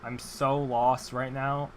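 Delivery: ordinary talk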